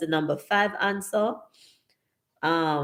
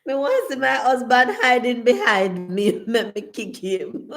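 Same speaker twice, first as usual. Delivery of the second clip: laughing; 5 semitones higher than usual. Real, not acted. Jokes about hiding husband.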